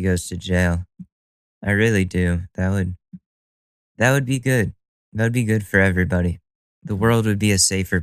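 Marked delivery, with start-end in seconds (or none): none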